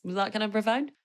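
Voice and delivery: usual speaking voice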